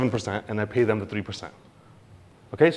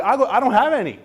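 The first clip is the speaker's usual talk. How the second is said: high, louder mocking squeak; mock squeal; 11 semitones higher, 6 dB louder than usual. Owner taunting the official, high mock squeal. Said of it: "You're like".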